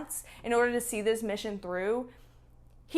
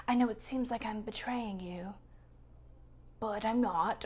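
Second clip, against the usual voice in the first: whisper; 10 dB quieter than usual. Acted. Whispers Strat's movie line.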